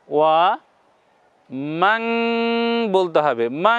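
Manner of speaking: ওয়া মাং with the নুন nasalized into the nose; nasalized demo. Shown as a demonstration. Teacher nasalizing মাং for Quran students. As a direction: say মাং through the nose, held. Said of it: "নাকের মধ্যে নিয়ে যেতে হবে".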